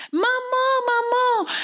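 very high, chanted call of mama; little ones calling. Acted. Thirteen little companions call the mother, very high. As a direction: pipe it like small creatures calling. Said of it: "die allemaal roepen".

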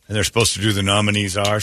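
ordinary talk

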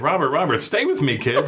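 put-on high, playful call to a wandering kid; coaxing a kid. Actor to a child on set, high coaxing call. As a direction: rein him in, cheerfully.

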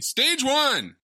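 shouted high game announcer call; video game announcer. Pretend. To his co-host, shouting a game stage start like an announcer. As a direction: announce it like an arcade stage intro.

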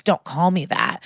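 usual speaking voice

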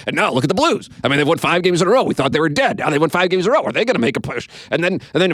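high, fast rattling of sports topics, building up; rapid-fire program director. Retold or imitated. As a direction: rattle off questions like a manic radio host, climbing to mock doom. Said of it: "he'd talk really fast and have topics and rattle them off".

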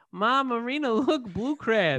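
put-on high, excited kid calling to mom. Son spotting crabs at the dock, high excited voice. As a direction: pipe up high, drawing out the last words. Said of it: "A J".

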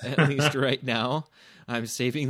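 laughing through the words. Real, not acted. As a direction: chuckle as you say it. Laughs on the line.

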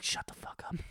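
hushed angry whisper. Retold or imitated. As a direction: hiss it so mom won't wake. Sneaking in, not waking mom.

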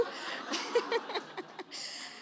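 breathy unvoiced chuckle, high; a short airy laugh of disbelief; imitated laugh. Mentor laughing at his answer, airy chuckle. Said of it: "and he just laughed".

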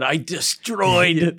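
slow, stretched-out mock boast; imitating a friend's proud announcement; drawn-out boast. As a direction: draw out the word for comic weight.

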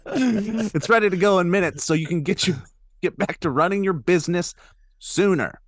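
upbeat sponsor read. Acted. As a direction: sell it like a podcast ad. Ad read resumed.

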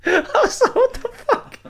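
very high, squeaky through laughter; real laughing disbelief. Real, not acted. Storyteller cracking up at the broken-arm apology, high laughing disbelief.

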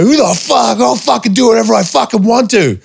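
high, hammed-up furious rant; retold fury, pitched high. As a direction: vent at the boss who stopped you. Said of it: "So I was like".

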